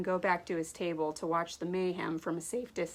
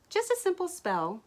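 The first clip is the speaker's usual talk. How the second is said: lightly voiced sly sister; 12 semitones higher, 3 dB louder than usual. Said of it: "Diamond replied".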